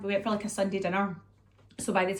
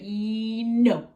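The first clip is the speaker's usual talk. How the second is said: held "ye" flipping into a louder "no"; imitated cheeky refusal; 2 semitones higher, 4 dB louder than usual. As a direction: tease a yes, then refuse. Imitates toddler teasing then refusing.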